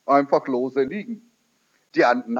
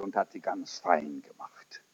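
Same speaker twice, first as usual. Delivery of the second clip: whisper; 9 semitones lower, 12 dB quieter than usual. Retold or imitated. Retelling a secret act.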